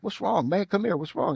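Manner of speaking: a touch of a worried friend; concerned teammate. Retold or imitated. Teammate asking, higher voice.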